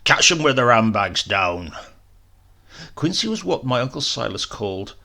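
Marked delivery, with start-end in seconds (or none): 0.0-1.7 loud, hearty bellow; boisterous shout of glee; Foghorn's roar. Acted. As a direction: roar it like a rowdy old soldier. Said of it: "Foghorn used to roar".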